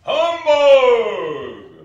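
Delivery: huge, high, drawn-out shout; roaring curmudgeon's outburst; scornful bellow. Acted. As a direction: explode in disgust. Scrooge rejects Christmas again.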